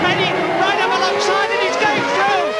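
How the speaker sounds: high, urgent race call; excited commentator. Real, not acted. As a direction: call the overtake at full pitch. Lead change called live.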